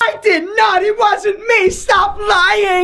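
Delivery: put-on high, shouted girlfriend denial; indignant girlfriend. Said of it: "they freak out and, like, get pissed".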